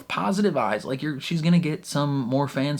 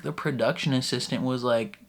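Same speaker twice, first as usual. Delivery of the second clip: usual speaking voice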